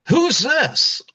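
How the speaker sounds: high indignant mock question; shocked wife. New wife spotting the other wife, a high shocked question. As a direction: ask it high and affronted.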